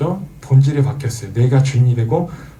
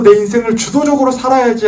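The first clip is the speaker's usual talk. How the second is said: self-assertive declaration; 9 semitones higher, 5 dB louder than usual. Acted. Person deciding to run own life.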